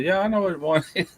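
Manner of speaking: laughing retort. Retold or imitated.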